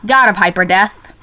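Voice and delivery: normal talking voice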